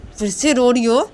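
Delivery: loud, high scolding; angry order. Acted. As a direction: shout at her to stop crying. Angrily ordering friend to stop crying.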